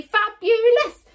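high, loud, drawn-out cheer; big stretched-out whoop of praise; celebratory cheer. Pretend. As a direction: sing out praise with gusto. Group cheer for the children.

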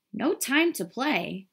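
lightly voiced storybook mole; busy mole. A narrator's reading. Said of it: "said the mole".

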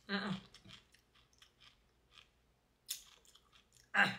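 talking while chewing food; words muffled by food; mouth full, chewing. Real, not acted. Seen 2: Eats by hand earlier; lips closed, chewing through 1.8s, then speaks.

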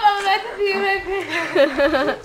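laughing and crying. Real, not acted. Laughing fit.

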